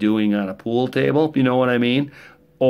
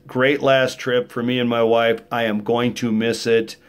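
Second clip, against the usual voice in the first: ordinary delivery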